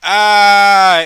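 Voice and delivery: high, loud startled scream. Acted. Girl shrieking at the zombie, high and loud. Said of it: "only with much more enthusiasm".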